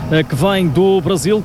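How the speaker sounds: ordinary talk, nothing marked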